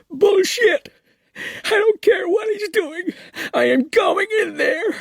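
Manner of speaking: a grieving mother bursting in, tearful and furious; crying out. Acted. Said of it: "a woman's voice cried".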